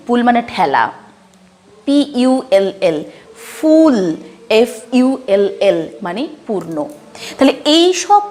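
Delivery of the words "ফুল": "ফুল" high and loud with held oo. Shown as a demonstration. Teacher giving an example word, "ফুল" with a drawn oo. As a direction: stretch the oo in "ফুল". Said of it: "সাউন্ডে উচ্চারণ".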